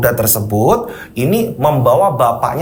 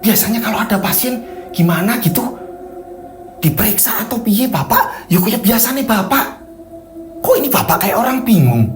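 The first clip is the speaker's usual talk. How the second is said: worried wife; 5 semitones higher than usual. Acted. Wife voiced in story.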